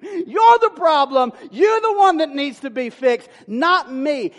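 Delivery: loud, slow, pointed blame; angry accusation. Retold or imitated. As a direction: shout it at your spouse like it's all their fault. Acted spouse quarrel quote.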